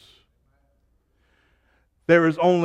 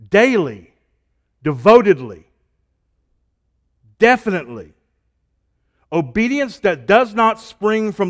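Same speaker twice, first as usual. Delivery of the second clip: loud, higher pulpit delivery with building repetitions; preaching at full force; 6 semitones higher, 8 dB louder than usual. Acted. Preacher urging the church to love God, preaching at full force. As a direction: press each "the more you" harder.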